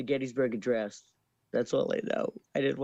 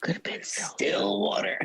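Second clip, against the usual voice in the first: unvoiced whisper; 7 semitones higher than usual. Shown as a demonstration. Guest whispering back at the host. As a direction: whisper the protest. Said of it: "does it have to be in a whisper".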